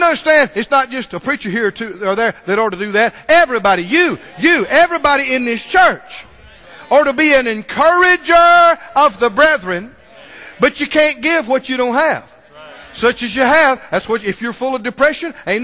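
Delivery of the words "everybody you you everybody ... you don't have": raised pulpit voice, pointed at the listeners; emphatic preaching. Real, not acted. Preacher charging everybody in this church, emphatic. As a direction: press the charge on the whole church.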